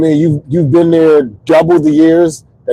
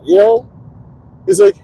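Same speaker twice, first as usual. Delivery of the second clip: ordinary talk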